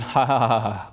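low, fading fake chuckle; mock polite laugh. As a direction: imitate a congregation's laugh, trailing off softly. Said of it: "And they went".

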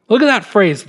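usual speaking voice

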